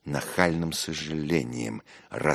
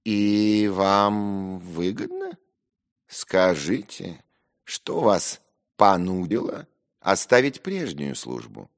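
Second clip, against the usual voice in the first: drawn-out, higher-pitched condescending inquiry; condescending questioning; 6 semitones higher, 4 dB louder, slower than usual. Acted. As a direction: stretch the words as you quiz an inferior. Acted probing of narrator at dinner.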